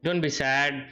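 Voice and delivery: ordinary delivery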